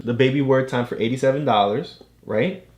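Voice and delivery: ordinary talk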